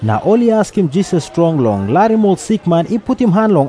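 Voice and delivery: usual speaking voice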